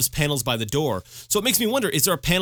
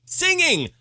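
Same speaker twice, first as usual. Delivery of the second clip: high burst of glee; excited yelp. Acted. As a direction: squeal the word out. Excited exclamation.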